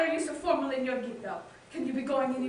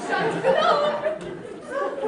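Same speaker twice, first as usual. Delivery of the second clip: high sing-song exit; 12 semitones higher, much slower than usual. Acted. Exits excitedly to call daughter.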